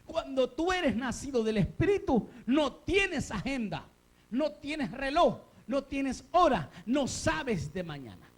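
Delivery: high, slow, drawn-out clauses; emphatic preaching. Real, not acted. Preacher teaches being led by the Spirit, high and deliberate.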